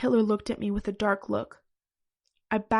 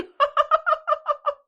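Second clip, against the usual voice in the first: high real laugh; shrieking laughter; 5 dB louder than usual. Real, not acted. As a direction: break into giggles. Reader laughing.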